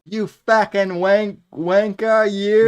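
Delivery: loud, high British insult; mock British accent. Trading fake pub insults, loud mock British accent. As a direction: hurl it in a hammed-up British accent. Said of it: "that kind of British accent".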